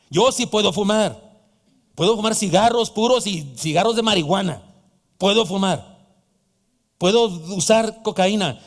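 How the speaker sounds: loud, driving sermon cadence; fiery pulpit preaching; preaching at full force. Acted. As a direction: hammer each point to the congregation.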